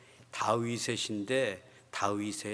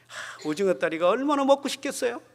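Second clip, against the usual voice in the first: teasing; 11 semitones higher, 6 dB louder than usual. Retold or imitated. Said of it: "이렇게 놀리는 거예요".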